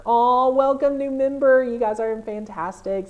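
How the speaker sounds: loud, high gush easing down; gushing welcome. Real, not acted. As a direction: gush over a new member. Welcoming a new member.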